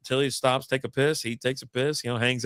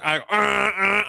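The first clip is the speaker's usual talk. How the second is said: long high held honk; imitated car horn; 8 semitones higher, much slower than usual. Driver laying on the horn at a closing garage, blaring. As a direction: blare like a leaned-on car horn.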